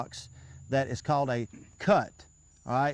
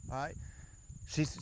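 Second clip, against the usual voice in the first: ordinary talk, nothing marked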